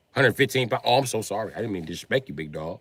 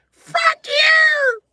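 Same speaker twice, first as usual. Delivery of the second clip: much higher, louder scream; furious shout; 11 dB louder than usual. Real, not acted. Pinned partner screaming furious insult. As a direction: yell it in rage. Partner yelling while held.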